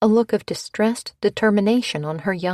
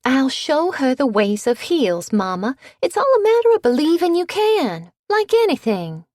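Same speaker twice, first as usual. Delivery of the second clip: much higher, louder perky cheer; perky belle; 8 semitones higher, 5 dB louder than usual. A narrator's reading. Bitsy to Maybelle, perky and much higher. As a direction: cheer her on brightly.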